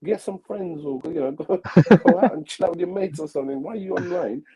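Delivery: high, scornful lecture; mocking family. Family telling son to get friends, high and scornful. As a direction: sneer at him to go get friends. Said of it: "they're like".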